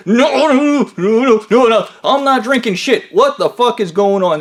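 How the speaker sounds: high, loud frantic no-no-no; panicked protest. Retold or imitated. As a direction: squeal in panic under the hold. Fred panicking in a finger hold.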